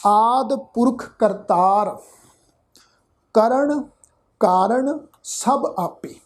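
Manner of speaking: slow, measured recitation of scripture; drawn out word by word. Shown as a demonstration. Teacher reciting scripture slowly for students. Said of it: "ਬਹੁਤ ਹੀ slow tone ਦੇ ਵਿੱਚ".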